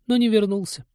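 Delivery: thin, very high plaintive voice; a pitiful little high-pitched lament; Полина, plaintive. A narrator's reading. Полина adds that he never came back, plaintive. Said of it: "жалобно добавила Полина".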